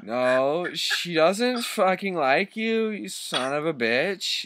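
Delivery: high, drawn-out mock yell without real volume. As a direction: yell it theatrically, holding back the volume. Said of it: "yelled Draco".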